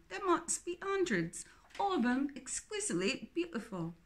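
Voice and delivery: excited. Acted. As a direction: gush about the find, voice lifting high. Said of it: "she said with excitement".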